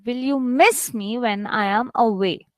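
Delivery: "miss" lifted, "away" falling; teacher's model with "miss" picked out; stress on MISS. Shown as a demonstration. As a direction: lean on "miss" and let the question fall at "away". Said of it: "Here I'm stressing".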